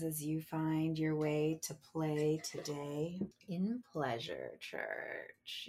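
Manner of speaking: normal talking voice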